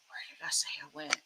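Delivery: murmured whisper while reading chat; whispering to herself. Real, not acted. Reading chat comments half to herself.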